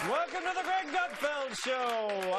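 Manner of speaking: slow, upbeat TV host welcome; show host intro. Acted. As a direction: open the show like an announcer. Host opening.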